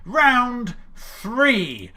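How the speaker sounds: drawn-out, booming announcement; round announcer. Acted. Quiz host introducing the next round, in a drawn-out announcer voice. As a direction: announce it slowly with big peaks.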